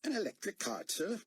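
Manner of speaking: put-on high Australian accent; light Aussie twang, eager. A narrator's reading. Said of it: "his accent making David guess he was an Aussie".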